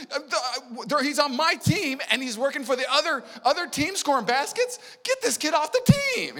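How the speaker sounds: put-on high, loud childish complaint; whiny kid. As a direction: whine like an upset eight-year-old.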